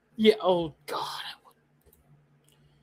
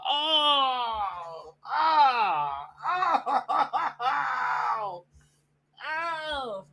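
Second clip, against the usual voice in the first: anguished wail; 5 semitones higher, 3 dB quieter, much slower than usual. Real, not acted. Fan wailing over costume.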